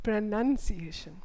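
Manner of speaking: very slow "pronunciation" with a "nun" syllable. Shown as a demonstration. Teacher repeating the word for learners, very slow "pronunciation" with "nun". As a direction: draw out "pronunciation" as pruh-nun-see-ay-shun. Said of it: "What is it?".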